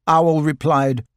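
ordinary delivery, nothing marked

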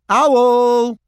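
high, loud call; shouting through a door. Acted. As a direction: call out to someone inside. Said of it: "he shouted through the door".

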